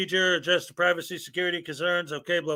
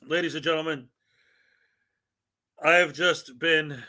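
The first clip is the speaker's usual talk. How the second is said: deadpan grave opener, no laughing; mock-serious announcement; 3 semitones lower than usual. Shown as a demonstration. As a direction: hold it together like a real news bulletin. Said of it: "talk like this is for real".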